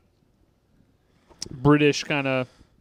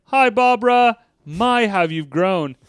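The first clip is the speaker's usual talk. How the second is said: high, louder start, then slow leering greeting; quoting Ed; 7 semitones higher, 4 dB louder than usual. Retold or imitated. Ed greeting Barbara, high then slow. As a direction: greet her like a smitten flirt. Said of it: "He's like".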